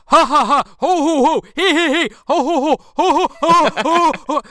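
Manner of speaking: unhinged mock Santa laugh; manic ho ho ho. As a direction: cackle like a madman in the Santa chair.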